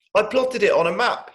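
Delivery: put-on high, loud eager little boy; excited child. Little brother showing his map, high eager child voice. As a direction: proudly show off the plan.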